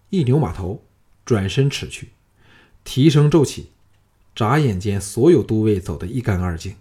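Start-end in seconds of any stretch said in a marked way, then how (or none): none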